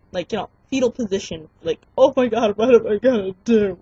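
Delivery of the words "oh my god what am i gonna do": loud mock-panicked wail; freaking out. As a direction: re-enact helpless panic at full volume. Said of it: "And I'm freaking out".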